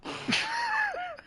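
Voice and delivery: high shrieking laugh. Real, not acted. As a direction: let out a shrill laughing shriek. Laughs at fair joke.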